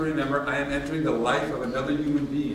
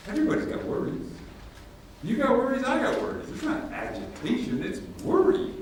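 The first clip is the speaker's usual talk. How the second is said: animated retelling; 11 semitones higher than usual. Retold or imitated. Retells reassuring Steve.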